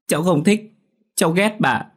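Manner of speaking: put-on defiant child. Child defies scolding grandmother.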